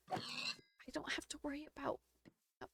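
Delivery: soft unvoiced whisper. Real, not acted. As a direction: drop to a breathy whisper. Quiet aside during gaming chat.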